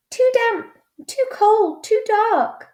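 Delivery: very high, tiny squeaking mouse voice; squeaky mouse. Acted. Mouse in the cave complains, in a high squeak. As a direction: squeak it like a wee shivering mouse. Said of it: "Mouse squeaks".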